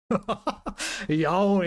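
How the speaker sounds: laughing "Yaon", said YA-ong; Korean pronunciation. Shown as a demonstration. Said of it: "let me check Korean".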